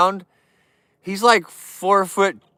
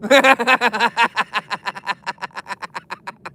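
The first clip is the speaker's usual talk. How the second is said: long rattling staccato laugh; 3 semitones higher than usual. Real, not acted. Streamer cracking up at his Tyson joke, long cackle. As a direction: cackle that slowly runs out of air.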